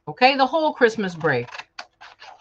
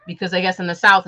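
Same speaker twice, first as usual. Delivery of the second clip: normal talking voice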